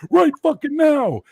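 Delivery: high, very loud, drawn-out shout; furious bouncer yell. Retold or imitated. Bouncer throwing a man out of the club, furious yell. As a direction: roar each word slowly in his ear. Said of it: "start walking him out in his ear going".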